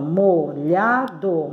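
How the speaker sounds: MOLHADO slowly split as mo-lha-do; molhado, each syllable slow and separate. Shown as a demonstration. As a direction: sound out molhado in three syllables. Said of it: "molhado tem três sílabas".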